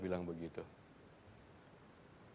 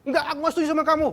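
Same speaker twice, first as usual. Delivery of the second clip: much higher and louder, fast, angry; disrespectful snapping; 14 dB louder, much faster than usual. Shown as a demonstration. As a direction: snap back at your husband. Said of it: "Itu nggak respect namanya". (membentak dengan marah, tinggi dan keras)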